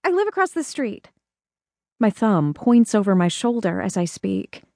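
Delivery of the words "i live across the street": put-on much higher, soft childlike voice, a bit fast at the end; a shy small girl meeting a neighbour; little girl voice. Young girl introduces herself.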